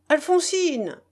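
high falling call of a name; hailing. A narrator's reading. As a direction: call someone out from the next room. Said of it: "héla Lambert".

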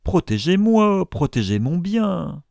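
high, loud plea easing off; panicky, high-pitched appeal; distraught cry. A narrator's reading. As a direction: beg desperately for protection. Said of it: "s'écria Isaac Acabut tout défait".

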